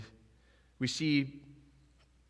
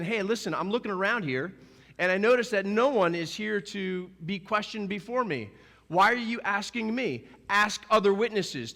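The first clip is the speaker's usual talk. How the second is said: Jesus protesting; 7 semitones higher, 4 dB louder than usual. Retold or imitated. Jesus answering his questioners.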